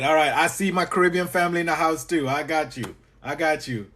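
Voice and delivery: usual speaking voice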